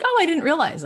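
high, sudden oh of realization; surprised. Retold or imitated. Viewer realizing, surprised and high-pitched. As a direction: squeak out the surprise.

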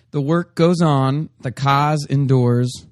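slow, measured recitation; solemn quote reading. Acted. Co-host reading the quote of the day, solemn and slow. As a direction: recite it slowly, leaving space between phrases.

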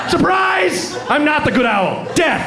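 high, taunting villain owl; spring out and gloat; escaped owl shrieking in triumph; gleeful owl attack. Acted.

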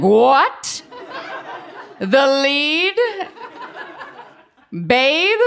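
high, rising questions of an astonished sister; mock disbelief. Older sister hears her crying sister got the lead, incredulous. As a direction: play stunned, pitch shooting up on each question.

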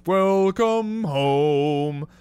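slow, drawn-out mushroom voice; talking mushroom. Retold or imitated. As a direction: stretch each word like a friendly ride character. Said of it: "talking mushroom that just goes".

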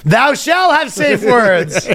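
high, slow, laughing sermon; mock preacher. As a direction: pronounce it like a commandment.

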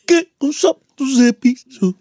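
slow drawn-out singer imitation; [name]. Shown as a demonstration. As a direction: drag the words slowly like a crooner. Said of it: "A [name]".

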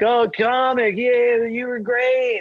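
put-on high, slow, sloppy drunk fan gushing. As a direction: gush like a tipsy fan after the show. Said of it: "And they're like".

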